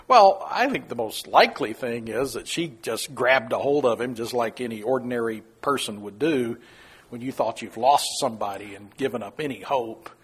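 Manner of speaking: normal talking voice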